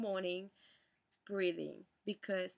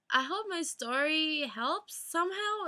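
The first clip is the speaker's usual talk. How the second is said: emotional; 6 semitones higher, 4 dB louder than usual. Real, not acted. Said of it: "sorry I got so emotional".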